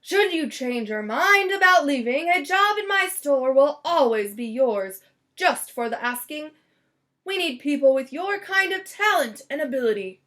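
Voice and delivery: lightly voiced kindly shopkeeper; generous Mister Grumps. Mister Grumps offering Valentini a job, hearty.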